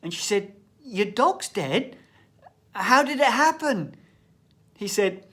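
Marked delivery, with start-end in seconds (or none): 0.8-4.0 shocked teacher. Retold or imitated. Teacher voiced in a joke.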